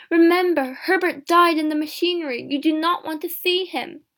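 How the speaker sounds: high, loud warning; urgent cry. A narrator's reading. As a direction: plead at full voice to stop her. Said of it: "he cried".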